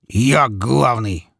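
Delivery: slow, drawn-out firm statement. A narrator's reading. Petka asserting himself over his dead father, firmly. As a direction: stretch it out with firm resolve. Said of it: "повторил он ещё раз твёрдо".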